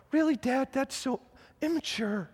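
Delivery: put-on high, soft little-kid voice; small child primly scolding his dad; five-year-old son. Five-year-old son to his joking dad, high childlike reproach. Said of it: "look at me as a five-year-old".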